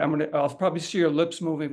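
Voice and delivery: ordinary talk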